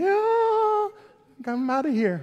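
high, loud comic wail, then bailing out; hammed-up horror at being called on; mock horrified. Churchgoer asked to pray in public, mock horrified. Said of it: "you would be horrified".

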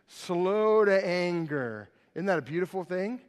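ordinary talk

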